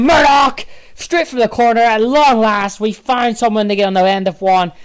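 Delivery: elated shouted commentary; excited goal call. Real, not acted. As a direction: roar the scorer's name, then keep it high and loud.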